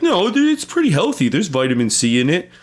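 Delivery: squeaky high imitation of a defensive coworker; high mock coworker voice. Mocking the coworker who defends orange juice, in a high squeaky voice. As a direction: pitch way up, mocking his defense. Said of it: "And he's like".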